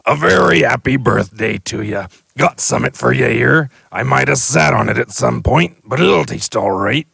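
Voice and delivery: loud, homely birthday wishes; hearty and offhand; lightly voiced giant. The giant wishing Harry happy birthday, loud and hearty.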